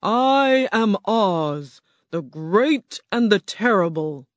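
slow, booming beast voice of Oz; grand slow roar from a huge animal. Acted. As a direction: roar it out slowly like a great beast. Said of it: "the huge animal roared".

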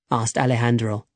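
ordinary delivery

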